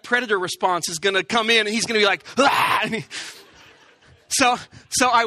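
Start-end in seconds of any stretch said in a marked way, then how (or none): 2.4-2.8 mock attack yell. Imagined attack from behind.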